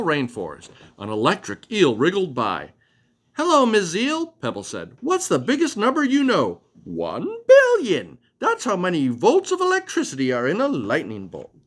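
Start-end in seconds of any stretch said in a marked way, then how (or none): 3.4-4.3 high, eager little butterfly; bright butterfly voice. Acted. Butterfly character voice, narrator reads. 5.0-6.6 high, eager little butterfly; bright butterfly voice. Acted. Butterfly asks question. 6.8-11.5 electric eel voice. Acted. Eel character speaks.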